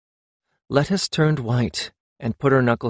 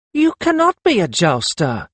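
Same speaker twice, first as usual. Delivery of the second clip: higher-pitched flat refusal to believe; disbelieving; 6 semitones higher than usual. A narrator's reading. Lettuce repeating her doubt to Perry, disbelieving. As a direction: insist it is impossible. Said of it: "about the sixth or seventh time".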